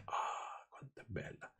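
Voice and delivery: whispered dreamy sigh over "bella"; mock swoon. Reader parodying writers' love of rare eyes, mock swooning whisper. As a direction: gush in a breathy whisper as a parody.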